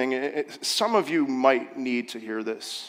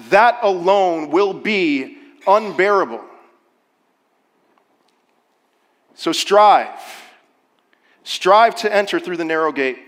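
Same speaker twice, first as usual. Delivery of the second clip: rising, louder preacher's urging; preaching at full force; 2 semitones higher, 6 dB louder than usual. Acted. Pastor urging his church to strive. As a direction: drive it home to the congregation.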